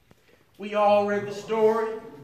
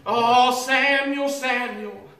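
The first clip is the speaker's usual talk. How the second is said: drawn-out call of a name, easing off; 4 dB louder, slower than usual. Retold or imitated. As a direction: imitate a voice calling the boy. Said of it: "heard a voice that called his name".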